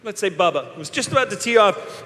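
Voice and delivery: ordinary talk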